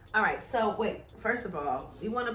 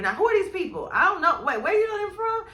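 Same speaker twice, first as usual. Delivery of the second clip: higher-pitched mock interrogation; worried questioning; 8 semitones higher, 3 dB louder than usual. Mother asks about her son's new friends, worried. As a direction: quiz him like a suspicious mom. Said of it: "a worried tip giver".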